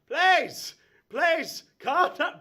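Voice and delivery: loud, high pleading shout. Acted. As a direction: shout to be heard over the riot. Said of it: "trying to raise his voice over the crowd".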